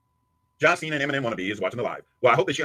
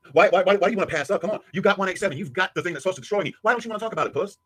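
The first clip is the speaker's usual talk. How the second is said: heated taunting; 8 semitones higher than usual. Real, not acted. Taunting rival over threat.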